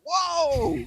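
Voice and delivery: high-pitched "whoa" of surprise; imitated startled exclamation; mock shock. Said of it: "It was like".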